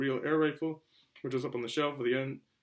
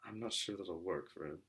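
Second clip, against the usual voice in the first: quiet doubt; 6 semitones lower, 11 dB quieter than usual. Acted. Petunia doubting husband.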